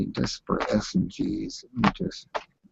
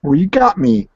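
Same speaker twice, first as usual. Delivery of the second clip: ordinary talk, nothing marked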